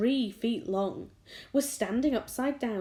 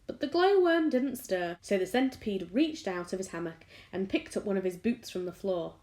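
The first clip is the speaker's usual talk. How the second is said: ordinary delivery, nothing marked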